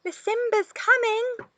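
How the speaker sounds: light, high warning call; soft call. A narrator's reading. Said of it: "she called softly".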